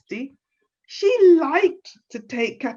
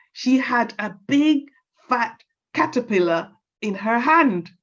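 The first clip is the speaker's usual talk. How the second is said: usual speaking voice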